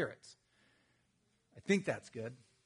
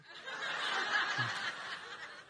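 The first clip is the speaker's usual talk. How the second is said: airy high-pitched laughter; high breathless laugh; 5 dB quieter than usual. Real, not acted. Pastor laughs at the post office clerk's silly rule.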